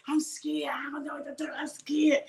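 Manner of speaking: hushed, half-whispered pretend fear; mock scared. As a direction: whimper it softly, imitating someone frightened. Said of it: "You scared".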